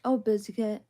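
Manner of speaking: usual speaking voice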